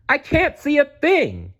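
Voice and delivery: very high squeaky bird yell. Acted. Pip flying blind at night, high panicked yell. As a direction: panic as a little bird in the dark. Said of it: "yelled Pip".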